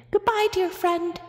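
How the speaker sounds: high, squeaky mouse farewell. A narrator's reading. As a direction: squeak it like a tiny tearful mouse. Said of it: "squeaked Amos".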